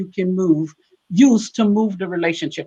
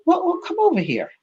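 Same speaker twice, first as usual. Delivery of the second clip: coaxing wife; 10 semitones higher than usual. Retold or imitated. Coaxing husband to other computer.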